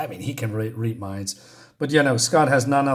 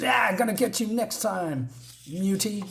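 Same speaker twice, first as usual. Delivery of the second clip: taunting threat; 8 semitones higher, 4 dB quieter than usual. Retold or imitated. Bully threatens Bobby.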